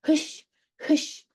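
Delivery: breathy "Hush, hush" like shooing hens. Acted. Shooing her mother off, a breathy "hush, hush". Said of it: "Go away, mother".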